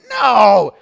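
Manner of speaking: emphatic shout of no. Retold or imitated. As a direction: bark it out like a reformer objecting. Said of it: "And they said it like that".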